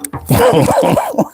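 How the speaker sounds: high-pitched burst of laughter; real laugh. Real, not acted. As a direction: crack up helplessly. Cracks up at own act.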